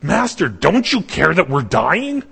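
drawn-out hammed-up appeal to the teacher; acted panicked plea. Disciples cry to Master in the storm, mock-panicked plea.

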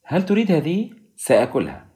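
high-pitched light question, settling on the answer; cheerful. A narrator's reading. Doctor eyes his patient's yogurt, cheerful. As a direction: breezily ask for the food. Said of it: "قال مكفدن في مرح".

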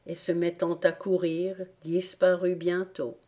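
ordinary delivery, nothing marked

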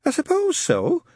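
high, hesitant answer; stuttering. Acted. Newton unsure answering Shadwell, high stutter. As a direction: stammer it nervously. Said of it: "Newt stuttered".

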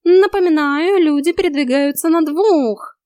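high, loud, drawn-out drill order; bossy cat coach. A narrator's reading. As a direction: call the exercise like a trainer. Cat mocks the stumbling narrator.

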